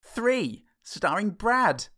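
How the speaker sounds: slow, high trailer voice; dramatic announcer. Acted. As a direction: draw it out like a trailer.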